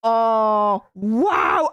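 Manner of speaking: usual speaking voice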